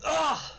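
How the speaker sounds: sharp high grunt when hit; knocked-back grunt; drow grunt of pain. Acted. Drow pushed back by the blast, high pained grunt.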